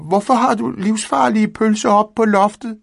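high, loud, whiny scolding cat; a small cat complaining loudly; scolding Findus. A narrator's reading. Cat scolding the old man about the sausage, high and loud. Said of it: "skældte han".

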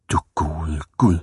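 put-on low, dismayed old-lady voice; appalled old lady. A narrator's reading. Second old lady sees the scribbled wall, appalled and low. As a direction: drop low in solemn disapproval.

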